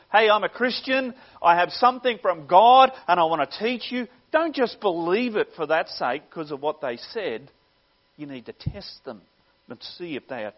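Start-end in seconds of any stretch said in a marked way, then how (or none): 0.1-4.1 louder, higher eager newcomer's pitch; self-proclaimed teacher. Acted. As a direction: announce yourself loudly, imitating a would-be teacher.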